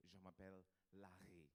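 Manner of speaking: soft throaty R in "Larry", quiet and posh-sounding French; fancy French accent. Shown as a demonstration. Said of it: "now the R is soft".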